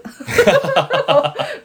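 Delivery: loud high laugh; 吹き出して大笑い; burst of laughter. Real, not acted. Laughs at the sign-off mix-up. (締めの挨拶を間違えて吹き出す大笑い)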